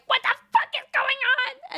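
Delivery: high, shocked friends; mock alarmed. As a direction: squeal it in alarm.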